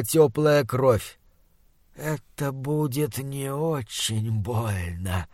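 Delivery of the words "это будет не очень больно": slow, hushed gurgling monster. Acted. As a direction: bubble the words through spit. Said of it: "пробулькало оно".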